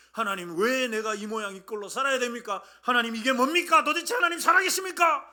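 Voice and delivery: loud protest climbing high; indignant complaint. Acted. Paul complaining to God under house arrest, indignant and loud. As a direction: build from protest to an outraged cry.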